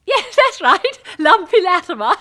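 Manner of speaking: loud, high hoot through laughter; hammed-up jolly guffawing; laughing recognition. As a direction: laugh heartily over the old nickname. Laughing recognition by old schoolfriend.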